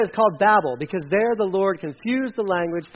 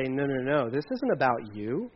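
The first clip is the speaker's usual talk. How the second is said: lower, softer quoted rebuke; understated gentle correction; 6 semitones lower, 7 dB quieter than usual. Retold or imitated. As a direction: voice God quietly setting people straight. God correcting the people, voiced.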